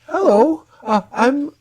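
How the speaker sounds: high-pitched, hesitant woman's voice; woman shopkeeper. Acted. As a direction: voice a polite flower seller at closing time. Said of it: "a tall, slender human woman".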